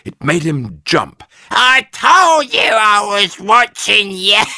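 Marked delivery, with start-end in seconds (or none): 1.5-4.4 much higher, loud slow gloating; cackling hag. A narrator's reading. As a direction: crow over him like a witch. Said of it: "cackled Missus Twit".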